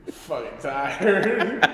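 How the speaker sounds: put-on slow, laughing drunk complaint; drunk laughing whine. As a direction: drag it out, laughing and wasted. Drunk self quoted, laughing.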